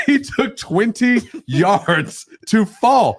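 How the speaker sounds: laughing through it, high and slow; cracking up as he tells it. Real, not acted. Telling the co-host about the fall, laughing hard.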